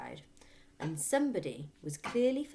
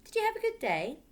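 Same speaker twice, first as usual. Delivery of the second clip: put-on sweet little girl; 7 dB louder than usual. A narrator's reading. Matilda's sweet voice.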